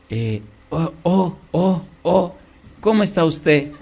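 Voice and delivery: mock nervous stammer; wife startled to see the pastor; trembling nervous. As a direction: stutter out of fright. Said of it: "viene temblando".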